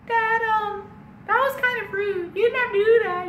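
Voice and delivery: put-on very high, timid kid voice; twelve-year-old boy. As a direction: gently reproach dad in a squeaky voice. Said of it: "I'm like this twelve-year-old boy".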